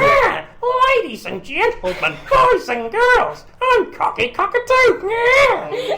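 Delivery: very high, loud, slow cartoon cockatoo showman; Cocky Cockatoo voice. Asked by the hosts, he voices his puppet bird, very high and loud. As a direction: squawk it out big like a puppet bird greeting kids. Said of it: "Can you do it for us?".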